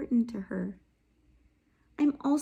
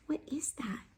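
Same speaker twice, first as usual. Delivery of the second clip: soft whispered question; 3 semitones higher, 11 dB quieter than usual. Pretend. Teacher modelling how to kindly ask a classmate about lunch, whispered. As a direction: ask it gently so only she hears.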